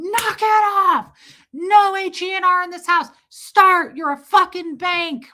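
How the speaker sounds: put-on high, loud furious yell at a child; imitating angry dad. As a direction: bark it like a raging father. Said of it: "My dad would say".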